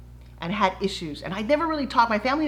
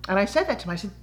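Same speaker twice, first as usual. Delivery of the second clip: ordinary talk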